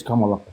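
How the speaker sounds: Kamala with Spanish vowels, KAH-mah-lah; Kamala pronounced the Spanish way. Shown as a demonstration. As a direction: say Kamala as in Spanish, all open a. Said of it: "If I want to pronounce it the way I speak Spanish".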